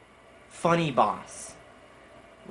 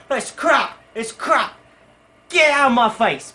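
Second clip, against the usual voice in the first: loud, high tirade of a harsh food judge; mock furious; 8 semitones higher, 7 dB louder than usual. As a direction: shout it as a hammed-up angry rejection. Imagined furious judge throws the shake.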